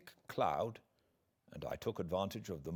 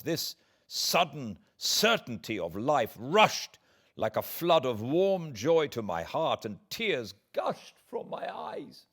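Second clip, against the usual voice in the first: rising joy; 7 semitones higher, 6 dB louder than usual. A narrator's reading. Joyful rescue, tears of relief.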